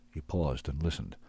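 ordinary delivery, nothing marked

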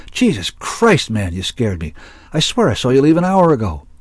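high-pitched exclamation after a scare; relieved astonishment. A narrator's reading. Zack to the barkeep who scared him, relieved astonishment. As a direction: blurt it out, startled but relieved. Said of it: "cried in relief and astonishment".

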